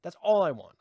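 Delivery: ordinary talk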